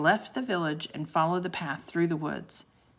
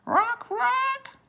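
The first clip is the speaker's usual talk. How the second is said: very high, croaky bird cry; raven croaking; 3 dB louder than usual. Acted. Raven repeating its name to the children, croaking. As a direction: caw the name twice like a raven. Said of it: "croaked the raven".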